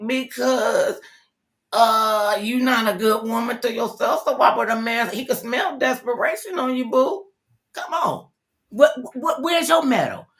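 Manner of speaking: high, loud sassy scolding; sassy tough love. Retold or imitated. Host scolds a desperate woman, high and sassy. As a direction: give them tough love with sass. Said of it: "I'm like".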